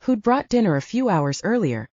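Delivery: ordinary delivery, nothing marked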